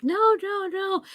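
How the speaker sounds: high, fading no's; panicked pleading. Retold or imitated. Judy begging Russell not to shoot, high panic.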